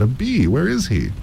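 mother startled, searching; alarmed cry. Acted. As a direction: look around for the bee. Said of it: "she cried".

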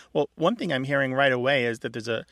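ordinary talk, nothing marked

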